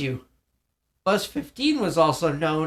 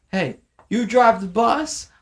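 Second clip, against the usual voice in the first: higher, louder cocky retort; mock tough talk-back; 8 semitones higher, 7 dB louder than usual. Bully tells the bus driver to stay out of it, cocky loud retort.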